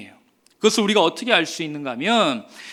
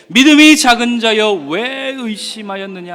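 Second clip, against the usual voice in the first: proclaimed scripture; 3 semitones higher, 8 dB louder than usual. Acted. Reading Jesus' words aloud.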